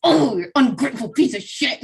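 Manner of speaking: mock angry mother through clenched teeth; imitated gritted-teeth scolding. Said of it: "grit her teeth and be like".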